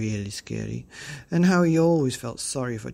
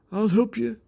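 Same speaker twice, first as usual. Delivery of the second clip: raised mock reassurance; bully voice; 9 semitones higher than usual. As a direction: call up to your brother. Brother answers.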